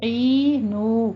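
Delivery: عینو drawn out with ع from mid-throat; tajweed demo. Shown as a demonstration. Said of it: "عین کو وسط حلق سے". (عینو میں عین وسط حلق سے، لمبا)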